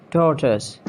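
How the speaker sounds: "tortoise" as TAW-tus; short S ending on "tortoise"; British pronunciation. Shown as a demonstration. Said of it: "It's tortoise".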